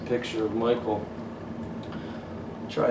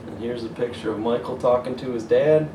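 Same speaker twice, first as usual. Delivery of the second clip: usual speaking voice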